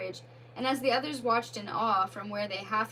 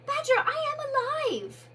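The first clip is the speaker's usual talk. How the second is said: small creature voice, much higher and louder; Mole's ghostly plea, pitched high; 5 dB louder than usual. Acted.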